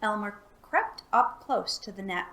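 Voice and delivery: ordinary delivery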